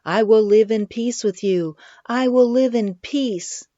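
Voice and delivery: put-on high, loud, defiant cry of a little girl; yelled as loud as she could. A narrator's reading. Little girl shouting at Brutus's house, loud and high. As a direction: plant your feet and shout it out. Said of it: "yelled as loud as she could".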